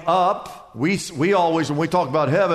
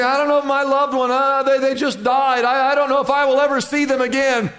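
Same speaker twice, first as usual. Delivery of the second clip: higher, fast, anxious plea; grieving believer; 5 semitones higher, faster than usual. Retold or imitated. Believer who lost a loved one pleading anxiously. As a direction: voice a grieving churchgoer's worry. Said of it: "We say".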